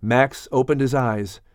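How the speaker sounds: usual speaking voice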